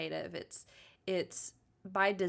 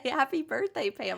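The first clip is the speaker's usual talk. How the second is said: bright, higher, louder birthday shout-out; excited greeting; 7 semitones higher, 5 dB louder than usual. Real, not acted. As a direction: burst out with the birthday wish. Sudden birthday shout-out to sister.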